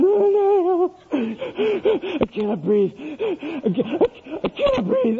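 high, gasping mock sobs; hysterical crying. Son panics before his spanking, hammed-up hysterical crying. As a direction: imitate a child wailing breathlessly.

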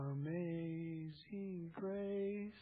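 soft, slow, half-hearted mumble of the hymn title; timid mumble. Shown as a demonstration. Pastor showing the congregation how not to sing it, a soft slow mumble. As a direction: say it like you barely care, drawn out and hushed. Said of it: "We should almost be yelling it".

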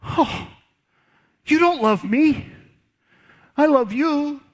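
high, whiny mock-hurt woman's voice; pouty Delilah. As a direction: play the wounded lover, pleading. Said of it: "And she said".

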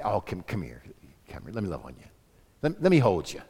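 put-on soft, low cooing to a baby; comforting a baby. Woman comforting a crying baby, soft and low. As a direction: hush a crying baby tenderly. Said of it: "Doesn't want to say".

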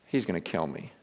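Isaiah before God, soft and low with dread; hushed dread. Retold or imitated. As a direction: let the fear drop to almost nothing. Prophet terrified before God.

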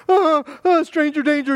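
high, loud, hammed-up startled kid; mock scared child. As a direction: play a child pretending to be spooked by a stranger. Mock frightened child's reaction.

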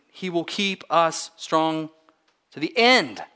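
slow build to a loud, high "end"; measured words, then punch "end"; stress on end. Shown as a demonstration. Preacher underlines the last word for his church, stress on "end". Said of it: "Or the last word".

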